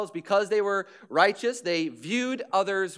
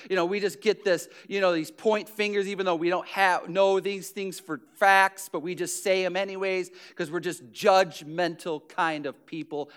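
normal talking voice

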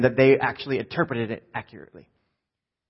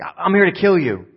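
raised, stammered start to a threat; loud threat; 4 semitones higher, 6 dB louder than usual. Acted. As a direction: blurt the threat out loud and high. Guard announces the killing to Daniel.

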